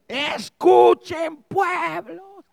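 high, very drawn-out shout; long ringing herald's cry; archangel's voice. Acted. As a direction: call out to the people like an archangel. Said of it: "sino como con voz de arcángel".

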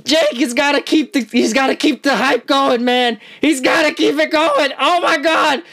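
ordinary delivery

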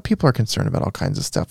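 hushed whisper. Acted. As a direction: drop to a whisper. Sudden hushed aside.

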